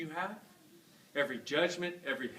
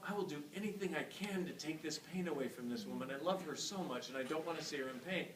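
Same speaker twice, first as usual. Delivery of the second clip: quiet vow; 8 dB quieter than usual. Retold or imitated. Husband's inner vow.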